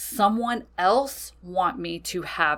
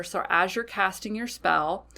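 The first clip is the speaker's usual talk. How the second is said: ordinary delivery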